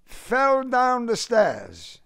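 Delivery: high, slow Southern reply; Southern accent. A narrator's reading. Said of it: "His southern accent convulsed them".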